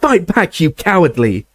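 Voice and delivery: high screaming insult. Acted. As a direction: hurl it as a scream. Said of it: "Harry screamed at him".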